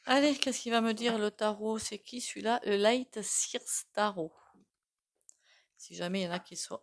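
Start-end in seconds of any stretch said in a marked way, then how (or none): 2.6-4.3 slow careful title. Real, not acted. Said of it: "vu mon accent".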